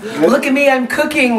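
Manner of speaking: high-pitched mock showing off; playful boast. Helper announcing they are cooking, playfully boastful. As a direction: pipe up high, proud as a kid.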